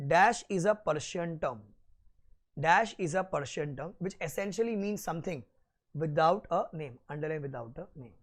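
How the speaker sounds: slow, deliberate note dictation. Acted. Teacher dictating notes slowly to students taking them down. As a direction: spell it out word by word for note-taking.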